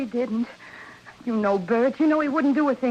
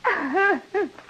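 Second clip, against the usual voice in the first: nervous laugh; 6 semitones higher than usual. Acted. Jeannie's tension breaks after standoff.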